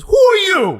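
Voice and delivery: very high, loud demand; shrill mock office lady; suspicious receptionist. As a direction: squeak it out suspiciously.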